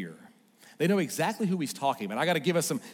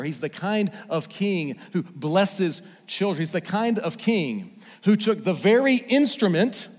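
building litany; 3 semitones higher, 5 dB louder than usual. Acted.

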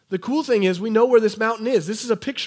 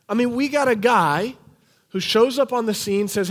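usual speaking voice

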